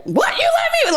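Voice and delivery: very high indignant squeak; offended husband squeaking in disbelief; husband outraged. Retold or imitated. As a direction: play the husband stung by the jab. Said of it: "Like, I'll do that".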